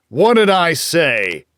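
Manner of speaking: roared. Acted. Drunk father roars at daughter after striking her. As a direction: bellow it down at the child. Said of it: "he roared".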